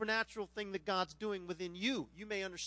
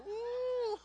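long, high-pitched "oooh"; hooting, impressed "ooh"; 4 dB louder than usual. Retold or imitated. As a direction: whoop a drawn-out ooh at a comeback. Reacting to the comeback in his story.

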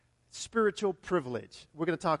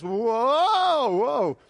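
louder staggering whoa; wobbly whoa; 5 semitones higher, 8 dB louder than usual. Shown as a demonstration. Pastor shows a shaky walk, loud whoa. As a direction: sound like losing balance. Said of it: "kinda more like this".

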